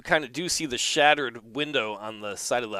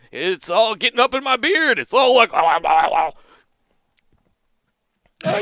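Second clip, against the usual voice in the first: put-on cranky old man ranting about spoons; grumpy old farmer; 7 semitones higher, 6 dB louder than usual. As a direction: grumble like an indignant old farmer. GM voices grumpy old Earl.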